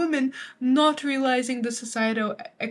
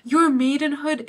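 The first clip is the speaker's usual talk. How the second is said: "Your maidenhood" said higher and louder, pleading; a lightly acted suitor saying "Your maidenhood"; mock pleading suitor; 4 semitones higher, 5 dB louder than usual. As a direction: push "Your maidenhood" up as a coaxing plea. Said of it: "He's saying".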